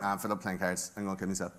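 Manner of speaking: low, soft, offhand giving up; understated, deadpan despair; resigned Epstein. Acted. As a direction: drop low and quiet in mock resignation. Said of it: "And then Epstein's like".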